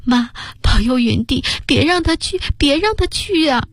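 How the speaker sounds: high pleading prayer through tears; tearful prayer. Acted. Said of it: "哭着祈祷".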